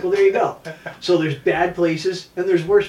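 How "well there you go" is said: loud, higher told-you-so; triumphant punchline. Retold or imitated. Instructor answers the lady's pen retort, loud and pleased. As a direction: land it loud like a win.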